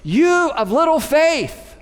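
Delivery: high, loud and slow call over the storm; loud rebuke. Acted. Jesus calling to his disciples over the wind, loud slow rebuke. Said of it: "And he says loudly".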